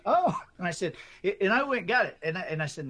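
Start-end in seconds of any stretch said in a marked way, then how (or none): 0.0-0.4 a sudden high, loud "oh" of surprise; surprised "oh". Retold or imitated. Retelling the wife's reaction to the manuscript offer, a surprised high "oh". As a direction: mimic the publisher's wife, caught off guard and delighted. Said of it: "And she went".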